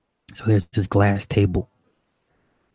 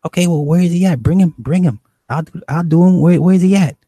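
raised, insistent mimicry of her; animated; 9 semitones higher, 8 dB louder than usual. Woman eagerly asking the dealer to bring him, animated. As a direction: imitate an eager woman, high and loud. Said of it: "she's very animated".

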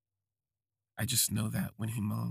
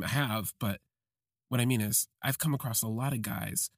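ordinary delivery

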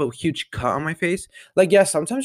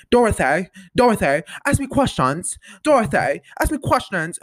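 higher, louder, nagging at her sister; mock pestering her sister for questions, louder; impatient Millie impression; 7 semitones higher, 4 dB louder than usual. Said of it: "She's like".

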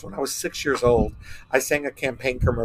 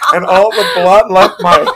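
laughing; 6 semitones higher, 7 dB louder than usual. Real, not acted. Laughing at embarrassing memory.

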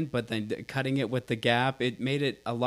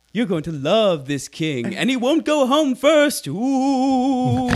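slow, high and loud hammy British voice, ending in a squeal; mock British accent; 11 semitones higher, 8 dB louder, much slower than usual. Co-host redoes the British line, louder and squealing. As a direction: build to a big campy finish. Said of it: "give this British accent a try".

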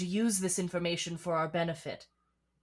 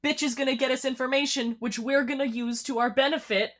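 much higher and louder sarcastic shouting; exasperated yell; 8 semitones higher, 7 dB louder than usual. Pretend. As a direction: yell the way she wishes she could. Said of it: "I can't yell at Edmundo".

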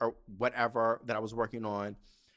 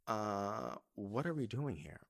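low, soft, cautious asking; retold timid question, voice dropped right down; hesitant question; 4 semitones lower, 8 dB quieter, slower than usual. Teen asking mom why they turned into the arena, hesitant.

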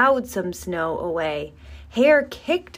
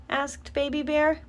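plain reading voice

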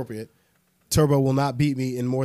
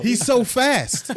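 laughing; 9 semitones higher, much slower than usual. Real, not acted. Friend just vanished from chat.

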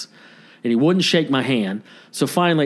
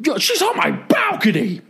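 high, hammed-up screeching neighbor; screaming neighbor. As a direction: shriek it like an outraged guy. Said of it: "Just screaming".

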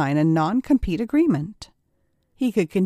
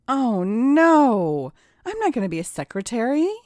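horrified protest, higher and insistent; alarmed refusal; 5 semitones higher, 4 dB louder than usual. Acted. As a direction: balk in dismay, pitch jumping up. Acted refusal of a forced job.

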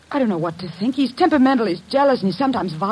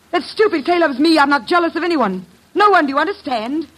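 higher and rising, building to anger; wife angrily denying she is jealous; indignant outburst; 7 semitones higher than usual. Acted. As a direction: snap back, offended and defensive.

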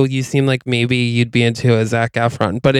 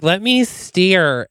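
put-on drunken begging; 6 semitones higher, much slower than usual. Drunk guest begs to steer.

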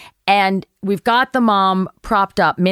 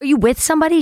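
raised pitch, eager to help; higher polite bystander voice; helpful bright question; 7 semitones higher, much faster than usual. Retold or imitated. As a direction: ask it like a chirpy helper. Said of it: "and I go".

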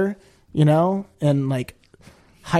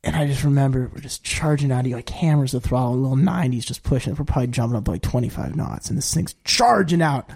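ordinary talk

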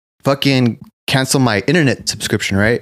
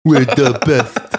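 slow, drawn-out mock boast; lightly voiced mock pride; mock proud voice; much slower than usual. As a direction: say it like a smug caricature, slowly. Joking about Nazis winning.

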